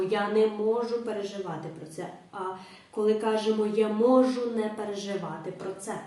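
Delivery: ordinary delivery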